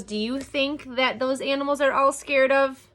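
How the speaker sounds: ordinary delivery, nothing marked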